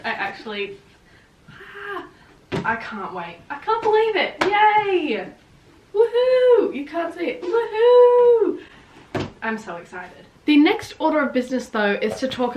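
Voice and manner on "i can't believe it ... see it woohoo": high, loud woohoo cheers; ecstatic shouting. Real, not acted. Celebrating her two favourite new books, whooping loudly.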